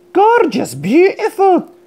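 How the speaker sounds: high, gushing praise; admiring gush. Acted. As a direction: coo it like a proud preener. Animals preening, admiring voice.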